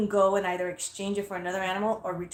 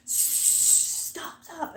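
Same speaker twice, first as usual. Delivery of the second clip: hushed shushing; 8 semitones higher, 5 dB quieter than usual. Retold or imitated. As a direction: imitate an urgent hushing "stop". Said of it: "She goes like this".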